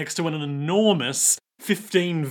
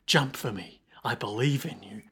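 low, soft near-whisper of encouragement; whispered pep talk; hushed coaxing; 5 semitones lower, 5 dB quieter than usual. Acted. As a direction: coax a small pet, very gently. Gwess coaxing bird from pocket.

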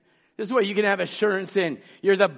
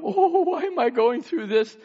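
mock whining; 7 semitones higher than usual. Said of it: "There's no whining".

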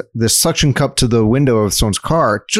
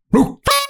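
squealing laugh. Pretend. Testing cuss collar.

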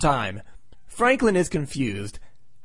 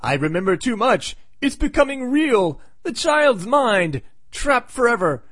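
put-on older Franklin; 7 semitones higher than usual. Grown son haunted by his memories, hammed-up anguish. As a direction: build to a tormented, higher-pitched cry. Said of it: "Older Franklin says".

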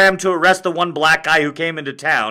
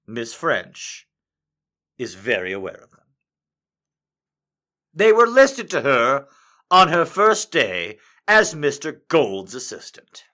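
measured storytelling read with deliberate pauses; story narration. Acted. Reads fanfic aloud.

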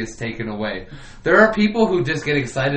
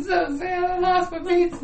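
mock crying. Mock crying over lost pizza.

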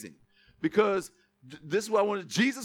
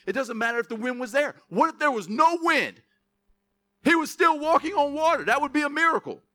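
higher, insistent sermon delivery; emphatic preaching; 5 semitones higher, 4 dB louder than usual. Acted. Preacher marvels at Peter on water, emphatic preaching. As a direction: press the point home to the congregation.

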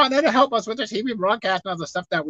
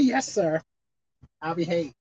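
meek obedient sea; 8 semitones lower, 8 dB quieter than usual. Acted. Sea meekly obeys.

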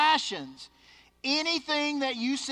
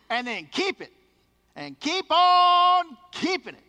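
mock greed; 6 semitones higher, 8 dB louder than usual. Mocking the greedy dream.

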